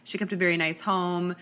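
ordinary talk, nothing marked

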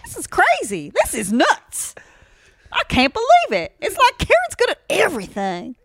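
loud, high bragging Texas twang; Texas accent. Pretend. Co-host gloating over her talent to actor, exaggerated Texas accent. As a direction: crow it in an exaggerated Texan accent. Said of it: "talk with a Texas accent from".

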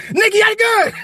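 high and loud, laughing; playing the trigger-happy guy; mock pleading. Insisting to Nick there was a gun, laughing. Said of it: "My shit be like this".

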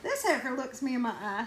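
usual speaking voice

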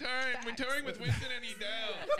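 airy, high Miami girl impression; mock a Miami party girl. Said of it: "every girl in Miami is like".

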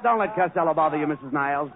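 usual speaking voice

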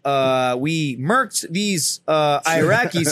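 very slow, halting admission; hesitant confession. Retold or imitated. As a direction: feel out each word before committing to it. Acts out hesitant confession to gunny.

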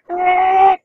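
a sudden, very high yelp of surprise; a shrill mock shriek as the door swings open; startled cry. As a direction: squeal it out as if caught off guard. Everyone reacts as he enters.

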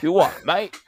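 put-on jokey Australian accent. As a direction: quizzical Australian bloke. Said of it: "Australian accent".

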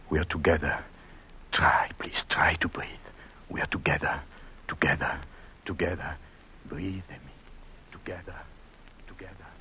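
very low, near-whispered rhythmic pleading; hushed coaxing. Acted. Husband coaxing his injured wife to breathe, hushed. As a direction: murmur each phrase softly, with steady gaps.

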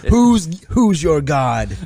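loud, high hammed-up gloating; mock taunt. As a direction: lord it over him for fun. Mocking adjuster as cocky boss.

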